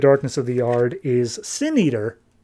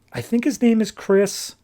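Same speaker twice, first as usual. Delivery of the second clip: ordinary talk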